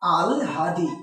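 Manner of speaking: আল হাদি split as "al-hadi", l before h; corrected pronunciation. Shown as a demonstration. Teacher correcting students, আল হাদি as al-hadi. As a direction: sound out আল, then হাদি with audible h. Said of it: "তাহলে এটার উচ্চারণ কি হবে".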